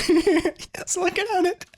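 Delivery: breathless laughing squeal; giggling squeak. Real, not acted. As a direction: squeal through laughter as he watches. Laughing at troll watching.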